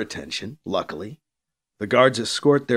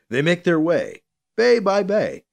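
ordinary delivery

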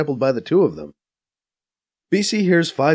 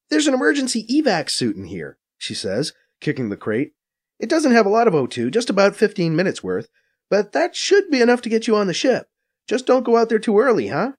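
higher, brisk Faiza voice, a bit scornful; Faiza explaining; 8 semitones higher than usual. A narrator's reading. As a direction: narrator playing Faiza, quick and knowing. Faiza instructing.

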